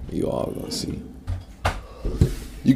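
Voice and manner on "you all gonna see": breathy whispered vow. Real, not acted. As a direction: whisper it like a promise to yourself. Vowing to prove friends wrong.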